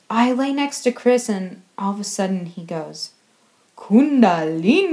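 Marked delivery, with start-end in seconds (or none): 3.8-4.9 lightly voiced loud strange accent. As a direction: imitate a spaced-out friend's odd accent. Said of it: "in this really strange accent".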